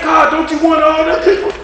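high, loud, shouted mock plea; pleading shout up to God. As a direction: cry out to God like a puzzled churchgoer. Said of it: "You would think".

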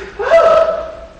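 high laughing gasp; playful gasp; mock gasp. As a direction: gasp in fake shock, then laugh. Mock gasp at others' mess.

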